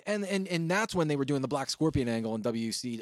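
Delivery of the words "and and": usual speaking voice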